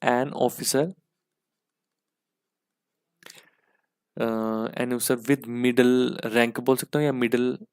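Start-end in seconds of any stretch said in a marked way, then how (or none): 0.1-6.6 slow dictation. Shown as a demonstration. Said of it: "teacher dictating definition".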